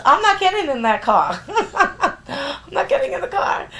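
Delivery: high, loud, laughing refusal; stunned woman refusing the car; shocked laughing refusal. Retold or imitated.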